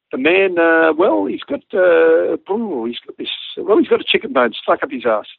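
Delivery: hesitant, with a rising hem and haw, then blurted out; reluctant radio report. Retold or imitated. Pilot breaking embarrassing news to Brisbane over the radio, reluctantly. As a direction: stall awkwardly before coming out with it.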